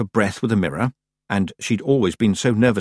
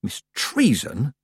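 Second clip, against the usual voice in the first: slow, higher-pitched reproof; severe; 5 semitones higher, slower than usual. A narrator's reading. Tiffany about to question the witch, severe. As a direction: stretch the name out as a warning. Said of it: "said Tiffany severely".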